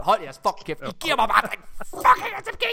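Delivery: mock rant.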